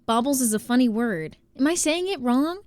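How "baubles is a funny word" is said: normal talking voice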